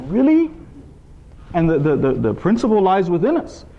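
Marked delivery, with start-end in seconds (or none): 0.1-0.5 high, incredulous "Really?"; a wide-eyed, doubting reaction; amazed really. Retold or imitated. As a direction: marvel at the story in disbelief. Listener's amazed reaction to story.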